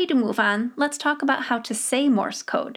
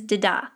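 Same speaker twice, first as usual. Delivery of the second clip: "da-da" as one word, "di-DAH", stress last; quick light first "da", strong second "da". Shown as a demonstration. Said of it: "Always put your emphasis on das".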